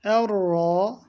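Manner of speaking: Erra with a heavy, thick rolled r; Erra, er-ra, dark r; thick doubled r. Shown as a demonstration. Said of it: "ra kalın okunacaktı".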